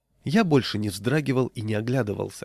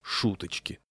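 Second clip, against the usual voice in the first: low-key, softer dark grumble; gloomy; 3 semitones lower, 7 dB quieter than usual. A narrator's reading. Husband pocketing a wrench after wife's reproach, gloomy. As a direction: grumble it quietly to yourself. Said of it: "мрачно сказал я".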